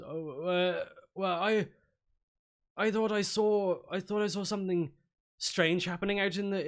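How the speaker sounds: a flustered tradesman explaining himself; nervous stonemason. Acted.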